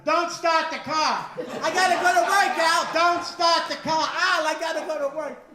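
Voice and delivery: high, loud, slow mock warning; Al Gore shouting. Al Gore yelling at the comic not to start his car, loud mock shout. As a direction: yell it down from the sky at the driver. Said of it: "Al Gore flying over my car going".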